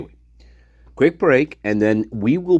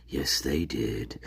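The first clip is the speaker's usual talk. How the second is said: whisper; 10 semitones lower, 13 dB quieter, slower than usual. Pretend. Whispered aside in his story.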